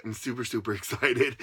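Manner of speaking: giggling through the words; laughing excitedly. Real, not acted. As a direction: bubble over with giggles.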